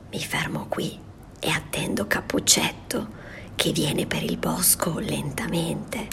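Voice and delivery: sly whisper. Acted. Wolf whispering his plan to wait for the girl.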